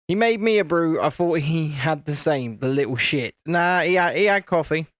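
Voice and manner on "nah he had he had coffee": usual speaking voice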